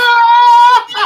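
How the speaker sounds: sudden high burst of laughter; loud laugh. Real, not acted. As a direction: crack up loudly, pitch flying up. Bursts out laughing mid-sentence.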